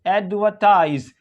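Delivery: slow एडवर्टाइज with a silent R; British pronunciation. Shown as a demonstration. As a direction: draw out एडवर्टाइज as "AD-vuh-tize". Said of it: "British English में नहीं पढ़ा जाता".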